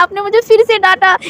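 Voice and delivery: high, wailing complaint; whiny fake crying. Wing wails at Lan Wang Ji for scolding him again, fake crying. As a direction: sob it out like a scolded child. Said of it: "ऐसे करके वो फिर से रोने लगा".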